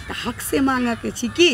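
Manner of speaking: ordinary delivery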